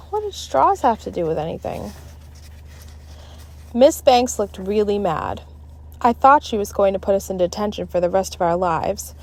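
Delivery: plain reading voice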